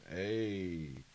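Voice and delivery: ordinary talk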